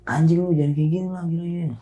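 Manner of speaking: high-pitched mock outrage; retold scolding. Camper scolding couple caught in the tent, high-pitched retold outrage. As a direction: replay a shocked telling-off, pitched well up.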